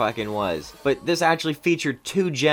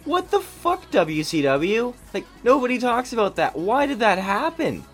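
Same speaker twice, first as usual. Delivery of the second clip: incredulous; 7 semitones higher than usual. Real, not acted. Baffled rant at old booking.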